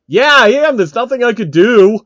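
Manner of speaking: high, loud whiny Phil voice; Phil's whiny voice. A narrator's reading. Phil admits to Kojima he will rage quit, high and whiny. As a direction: whine it up high, giving up. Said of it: "looking depressed".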